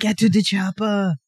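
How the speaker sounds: lightly voiced Austrian accent; Austrian action hero. Joking segue to a friend's Austrian impression, mild accent. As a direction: growl the movie line like an Austrian strongman, mild. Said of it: "to your Austrian".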